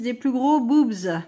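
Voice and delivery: borrowing her son's cheeky word with a grin. Retold or imitated. Mother quoting her son's word to viewers, slow and teasing. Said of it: "comme dirait mon fils".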